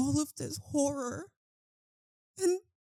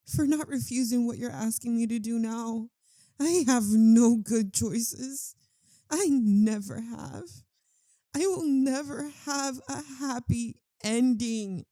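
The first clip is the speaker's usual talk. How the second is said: louder, anguished cry of despair; anguished outburst; 8 dB louder than usual. Acted. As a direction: let the despair burst out loud. Vampire's despairing outburst to lover.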